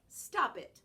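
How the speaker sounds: a short, loud scolding snap; sharp voice. Acted. Hattie scolding her jumping dog, short and loud. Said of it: "she says in a sharp voice".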